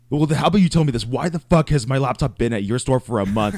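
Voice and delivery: loud, high, furious demand; angry customer yelling. Retold or imitated. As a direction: yell it like an outraged customer. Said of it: "call me and cuss me out".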